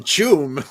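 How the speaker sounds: loud booming noise; comic-book sound effect. Acted. Repeating the new onomatopoeia for fun, booming sound effect. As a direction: punch it out like a blast. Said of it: "it's a new onomatopoeia".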